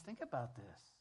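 usual speaking voice

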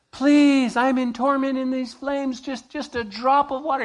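high, pleading voice from the flames; rich man begging. Retold or imitated. Rich man in torment begging Abraham, pleading high. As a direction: beg desperately for one drop.

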